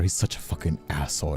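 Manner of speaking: usual speaking voice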